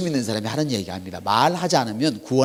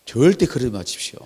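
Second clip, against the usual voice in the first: normal talking voice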